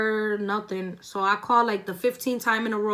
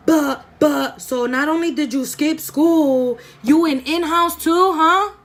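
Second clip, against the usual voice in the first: higher and louder, slow, furious scolding; mock angry mom; 7 semitones higher, 8 dB louder, slower than usual. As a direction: imitate mom yelling at her caught kid. Mom scolding her at home.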